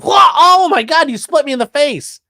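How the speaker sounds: high, slowed shout of surprise; hammed-up stabbed man's shock; mock shocked yelp. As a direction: yelp like you just got cut. Acted stabbing victim shock.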